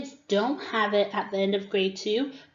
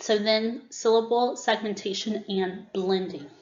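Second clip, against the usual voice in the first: ordinary delivery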